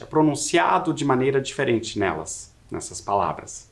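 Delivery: ordinary talk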